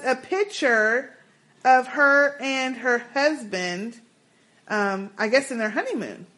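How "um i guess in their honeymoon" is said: usual speaking voice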